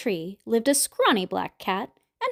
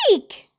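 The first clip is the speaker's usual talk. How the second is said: higher, louder eek; ghost shriek; 6 semitones higher, 5 dB louder than usual. A narrator's reading. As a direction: squeal in fright like a ghost. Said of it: "The ghosts shrieked".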